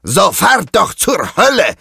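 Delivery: high, loud defiant roar back; pirate roar. A narrator's reading. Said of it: "brüllt Carlos zurück".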